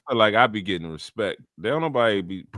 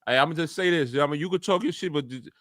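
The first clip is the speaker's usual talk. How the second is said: imitated restrained warning; 5 semitones higher, 4 dB louder, faster than usual. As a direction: voice a friend holding back while setting terms. Acting out Reedy's warning to the fan.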